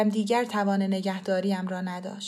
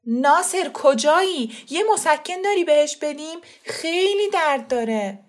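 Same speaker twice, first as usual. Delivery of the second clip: loud, higher urgent call, slowed; 7 semitones higher, 6 dB louder than usual. A narrator's reading. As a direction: call through the house for help. Uncle runs in, calls out.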